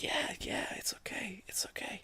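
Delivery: hushed unvoiced reassurance; soothing whisper. Reassuring a friend about spilling water, in a whisper. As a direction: whisper it soft and comforting. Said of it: "and I was like".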